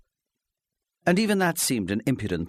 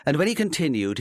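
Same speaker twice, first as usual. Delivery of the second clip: ordinary delivery, nothing marked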